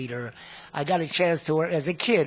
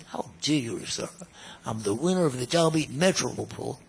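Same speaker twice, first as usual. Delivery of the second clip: lower, softer, lightly put-on Boston accent; a hint of a haughty Boston accent, lower; eloquent Boston accent; 4 semitones lower, 4 dB quieter than usual. Serge putting down the manager, lightly put-on Boston accent. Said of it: "he had a very eloquent accent".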